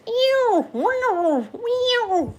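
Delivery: high yowling cat cries; cat yowls. Retold or imitated. Mimicking cats heard in a dream, high yowls. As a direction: yowl like cats in the next room. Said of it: "like cats".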